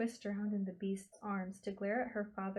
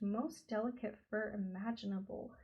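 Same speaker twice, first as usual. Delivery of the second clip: ordinary delivery, nothing marked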